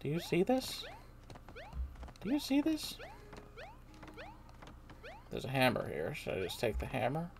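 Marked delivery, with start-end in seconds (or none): 2.2-3.0 much higher rising do you see this; high playful question. Acted. Player repeats the question in a high voice. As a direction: say it up high and teasing. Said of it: "higher retake".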